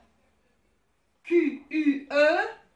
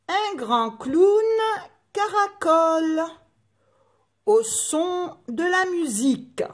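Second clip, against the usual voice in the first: lecture de comptine; 6 semitones higher than usual. Shown as a demonstration. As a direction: lecture lente et scandée, voix plus haute. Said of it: "je vais lire uniquement les trois premiers vers de la comptine". (comptine lue lentement, en détachant chaque groupe de mots)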